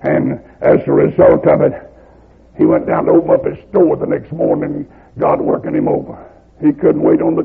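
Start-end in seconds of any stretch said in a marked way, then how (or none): none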